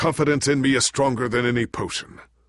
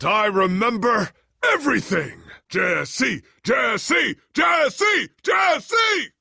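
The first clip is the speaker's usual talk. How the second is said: rising, ever louder calls of joy; excited shout; 12 semitones higher, 4 dB louder, slower than usual. Acted. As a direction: call out to Jesse overjoyed. Memory restored, calling out.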